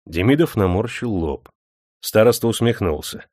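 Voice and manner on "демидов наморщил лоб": ordinary delivery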